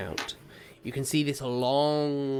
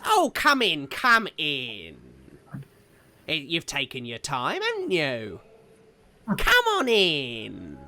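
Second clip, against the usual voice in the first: put-on old hag; 5 dB louder than usual. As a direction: usher them in like a gloating old crone. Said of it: "she looks old and decrepit".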